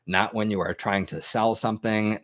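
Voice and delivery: ordinary talk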